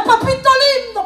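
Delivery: mock wailing prayer. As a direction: ham up a weeping plea to God. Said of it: "ahí gemía, ahí lloraba".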